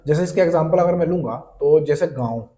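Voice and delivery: ordinary delivery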